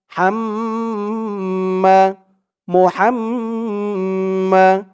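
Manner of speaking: long held nasal hum on the doubled m in "হাম্মা"; slow recitation with a lengthened humming m; nasal ghunnah. Shown as a demonstration. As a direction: draw out the "ম্ম" of "মুহাম্মা" as a slow hum. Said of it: "এখানে ওয়াজিব গুন্না".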